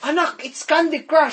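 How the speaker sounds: high, insistent Filipino mother; Filipino accent. Retold or imitated. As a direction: insist like a Filipino auntie to her child. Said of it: "now we're going to accents".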